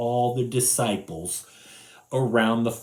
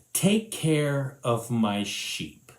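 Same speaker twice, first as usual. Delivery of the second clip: slow gentle command; 2 semitones lower, slower than usual. Acted. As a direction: say it slowly and kindly. Jesus gives command.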